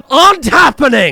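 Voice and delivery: loud, high burst of frustration; exasperated shout. Real, not acted. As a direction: let the complaint erupt into a yell. Ranting at characters ignoring priorities.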